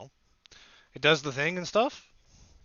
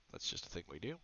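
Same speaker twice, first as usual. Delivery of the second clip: ordinary talk, nothing marked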